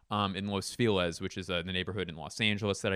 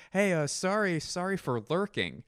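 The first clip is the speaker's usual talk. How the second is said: retold girlfriend; 8 semitones higher than usual. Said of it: "she goes".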